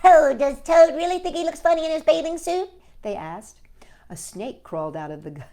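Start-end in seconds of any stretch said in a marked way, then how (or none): 0.0-2.7 much higher, louder eager lizards; gossipy lizards. Acted. Lizards eager to see Toad's suit. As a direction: squeal the question.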